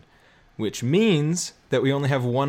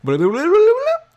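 tape gibberish; 10 dB louder than usual. Acted. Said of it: "I actually have it on tape ready to go".

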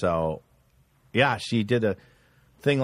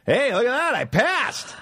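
high, proud announcement; imitating a pleased kid; proudly happy; 10 semitones higher than usual. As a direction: show off good news to your dad. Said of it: "I was honestly happy about it".

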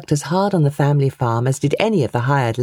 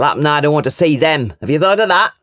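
gruff retort; 4 dB louder, faster than usual. A narrator's reading. Uncle voiced in quarrel.

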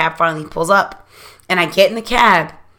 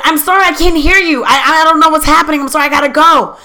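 high, raised calling voice; loud mock apology; 9 semitones higher, 6 dB louder than usual. Woman in cab calling to her date, high loud mock apology. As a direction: call the excuse out over the street.

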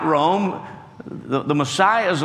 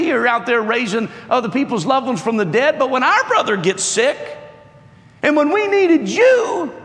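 Martha accusing Jesus of not coming; bitter Martha; 7 semitones higher than usual. Acted. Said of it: "bitterness in Martha's voice, in her tone".

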